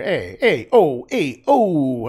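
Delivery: loud, rising Italian calls; mock Italian. Painter echoes a comedy show, mock Italian shouts. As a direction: shout the interjections like a caricature Italian. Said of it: "that Italian kind".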